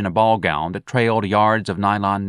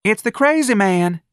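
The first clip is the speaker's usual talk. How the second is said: crying out; 12 semitones higher, 4 dB louder than usual. Acted. Darlene cries out, recognising Ignatius.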